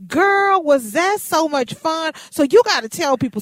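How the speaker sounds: loud, high delighted recall; excited banter. Real, not acted. Host to coworker recalling the morning, loud and delighted. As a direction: gush about the fun morning.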